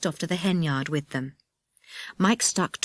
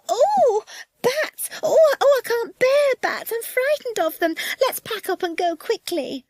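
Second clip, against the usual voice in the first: very high, loud woman's shriek; panicked shriek; 5 dB louder than usual. Acted. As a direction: shriek in hammed-up terror of bats. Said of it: "she gave a shriek".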